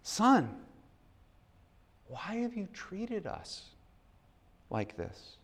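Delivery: soft, slow, hurt plea; a mother's wounded question; pained mother. Acted. Mary asking her son softly. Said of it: "His mother said to him".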